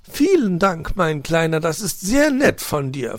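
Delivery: loud, high, pleased magpie; delighted. A narrator's reading. Magpie hostess thanks little fox for branches, delighted. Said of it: "freute sich Frau Elster".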